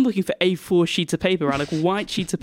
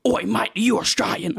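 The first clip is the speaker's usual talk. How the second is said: higher-pitched mock officer challenge; police officer; 4 semitones higher than usual. Police officer questioning his nationality, mock challenge. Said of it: "if a police officer said".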